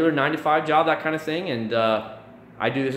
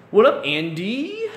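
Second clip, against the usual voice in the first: drawn-out greeting; 9 semitones higher, 4 dB louder, slower than usual. Real, not acted. Greeting a viewer in chat.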